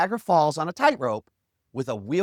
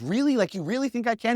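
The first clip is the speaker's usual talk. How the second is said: high, incredulous double-check; surprised questioning; 7 semitones higher than usual. Retold or imitated. Tightrope walker to a reporter who believes, surprised questioning. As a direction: ask in acted disbelief, pitched up.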